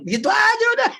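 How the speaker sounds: high, loud gleeful punchline; cheeky comeback; laughing retort. Acted. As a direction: toss it off with a big laugh in the voice. Joking self-defence reply.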